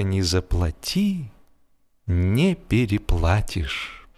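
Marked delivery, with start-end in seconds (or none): none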